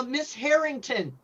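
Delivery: usual speaking voice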